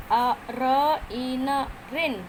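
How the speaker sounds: अ र ई न spaced very slowly, then रिन; sounding out. Shown as a demonstration. Teacher spelling out रिन sound by sound, slow. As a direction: separate each sound, then blend रिन. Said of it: "फ".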